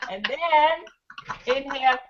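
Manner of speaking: calm, slow instruction; slow guiding voice. Shown as a demonstration. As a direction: lead the breath gently. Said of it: "guiding the exercise slowly".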